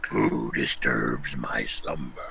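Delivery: very low, slow booming stone voice; Cave of Wonders voice. Acted. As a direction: rumble it out like a giant cave. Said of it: "that was [name]'s imitation of the Cave of Wonders".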